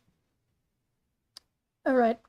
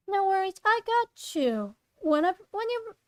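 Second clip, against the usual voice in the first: high-pitched friendly friend; Zoe's voice; 6 semitones higher than usual. Acted. Zoe texting Claire back, high character voice. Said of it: "Zoe comes back with a message".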